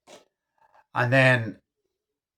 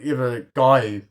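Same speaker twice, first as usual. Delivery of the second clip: ordinary talk, nothing marked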